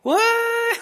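high, squeaked what; mock surprise. Preacher mocks atheists with high mock surprise. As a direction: fake baffled what.

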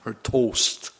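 put-on Wisconsin accent with drawn-out O vowels; long, round Wisconsin O. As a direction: say it like someone from Wisconsin. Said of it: "like being from Wisconsin and saying boat".

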